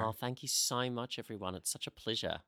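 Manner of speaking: put-on softly gushing Australian accent. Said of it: "which is my Australian accent".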